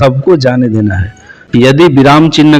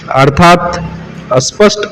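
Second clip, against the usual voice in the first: normal talking voice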